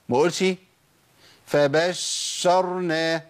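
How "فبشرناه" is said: فبشرناه with the sh held far too long; over-held shin. Shown as a demonstration. Teacher showing "too much", over-held shin in فبشرناه. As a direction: drag the sh of فبشرناه to excess. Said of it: "لا، ده كتير قوي كده".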